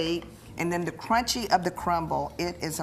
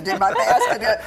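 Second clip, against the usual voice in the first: louder, quicker laugh through food; laughing with mouth full; 3 semitones higher, 8 dB louder than usual. Real, not acted. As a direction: chuckle round a mouthful. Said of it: "talk with your mouth full".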